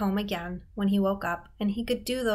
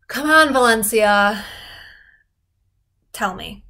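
loud, slow, urging voice; pushing a friend to tell; slow, loud, insistent coaxing; eager plea; 9 dB louder than usual. Acted. Character pleading in dialogue.

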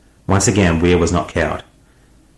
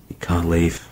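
whispered; 5 dB quieter, slower than usual. A narrator's reading. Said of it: "Weir whispered".

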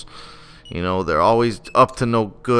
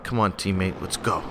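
low, soft urging under the breath; hushed; 5 semitones lower, 9 dB quieter than usual. Acted. Player urges teammate on ninja mission, hushed. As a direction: keep it down, sneaking in. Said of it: "why are we whispering".

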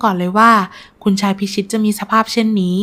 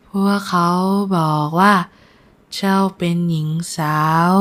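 weak, halting delivery with long pauses; ailing nobleman, sincere. Acted. Sick nobleman praising his intended bride, frail and sincere.

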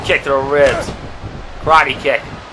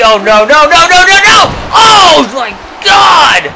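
high, loud, frantic cries of no; excited screaming; 10 semitones higher, 5 dB louder than usual. Real, not acted. Commentator watching a brutal suplex, excited high screaming. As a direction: scream it like you can't believe your eyes.

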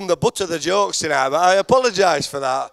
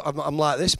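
ordinary talk, nothing marked

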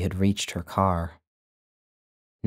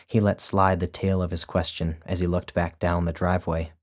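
ordinary delivery, nothing marked

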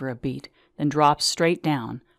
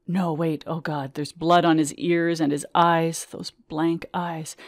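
plain reading voice

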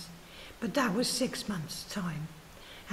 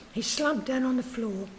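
plain reading voice

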